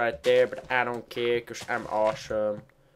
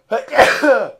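coughing; 11 semitones higher, 12 dB louder than usual. Real, not acted. Sudden cough fit.

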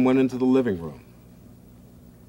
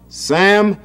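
high, loud, slow yell; big brother yells to make him drop it; 6 semitones higher, 6 dB louder, much slower than usual. A narrator's reading. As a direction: brother yelling at little Sam. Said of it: "yelled George".

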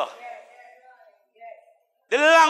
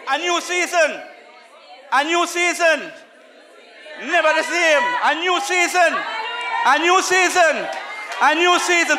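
high, loud, chanted refrain hammered again and again; fiery revival preacher calling out; preaching at full force; 5 semitones higher, 4 dB louder than usual. Acted. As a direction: whip the congregation up, shouting each phrase. Preacher chanting new season.

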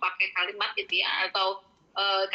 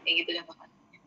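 ordinary delivery

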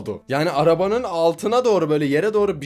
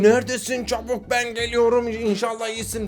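mock panicked husband; 6 semitones higher than usual. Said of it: "Kocası çığlık atıyor".

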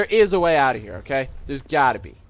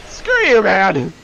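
exasperated yell; 7 dB louder, much slower than usual. Real, not acted. Angry at obstacle in game.

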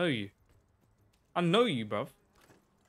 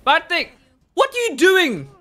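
bad thing shouted high and loud; high yell; 13 semitones higher, 12 dB louder, slower than usual. Real, not acted. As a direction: yell at the player in disbelief. Yelling at a player in the game.

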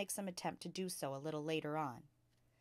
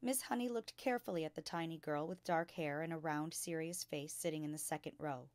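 usual speaking voice

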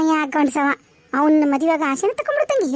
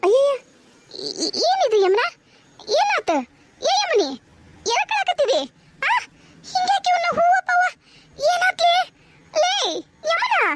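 high-pitched, distraught alarm; 11 semitones higher than usual. Acted. Mother finding her daughter crying among torn flowers, alarmed. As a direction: cry out in rising panic.

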